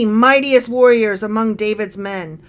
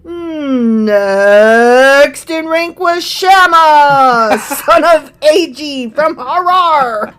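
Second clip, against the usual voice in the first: slow, higher announcer boom; 3 semitones higher, 6 dB louder, much slower than usual. Acted. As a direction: stretch it out like a game-show announcer. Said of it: "the announcer voice".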